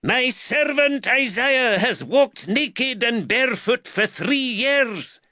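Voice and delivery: high, slow Scottish cry; drawn-out proclamation in broad Scottish; thick Scottish accent. A narrator's reading. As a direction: cry it out like a wild Scottish preacher. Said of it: "cried out in a thick Scottish accent".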